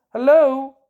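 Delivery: loud repeated hello, waiting for a reply; calling out on phone. Real, not acted. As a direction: call down the phone for someone to answer. Calling into silent phone line.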